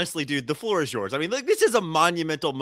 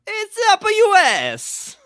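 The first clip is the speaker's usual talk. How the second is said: put-on high, loud, slow Italian accent; 8 dB louder, slower than usual. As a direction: punch it like a Chico Marx Italian. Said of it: "he said in an Italian accent".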